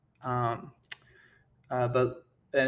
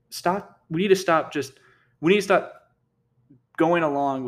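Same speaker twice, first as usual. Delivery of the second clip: fervent, climbing, louder plea; building insistence; 4 semitones higher, 6 dB louder than usual. Real, not acted. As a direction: stop rising higher and louder each time. Ranting, demanding it ends.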